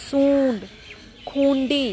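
सूंड, खूंडी with long vowels through the nose; nasalised vowel. Shown as a demonstration. Teacher reads anunasik examples, nasalised सूंड, खूंडी. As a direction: hold each nasal vowel, words set apart. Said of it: "अनुनासिक में ये आवाज़".

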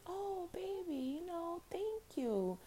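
put-on high, soft old lady voice; old auntie voice. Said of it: "she was like".

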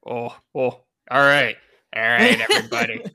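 loud, brisk cafeteria lady; bossy lunch lady calling kids. Acted. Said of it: "You gave her lunch lady voice".

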